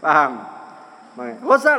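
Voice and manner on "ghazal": high, loud one-word call; urgent alert. Acted. Hunter alerting his friend at once, acted urgent shout. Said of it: "langsung ngomong".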